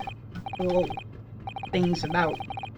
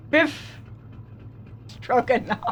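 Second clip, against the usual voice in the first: mock Russian accent; 4 dB quieter, slower than usual. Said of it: "Beef stroganoff".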